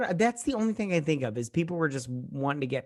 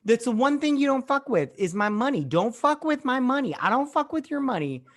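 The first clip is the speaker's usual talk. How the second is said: heated rant; 9 semitones higher than usual. Real, not acted. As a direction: rant about it hard. Heated rant about money.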